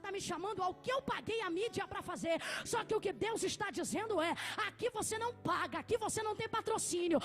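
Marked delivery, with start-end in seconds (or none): 2.7-7.2 sermon rising into a loud shout; building preaching. Acted. Preacher declaring character cannot be bought, building preaching. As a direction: build steadily to a forceful cry.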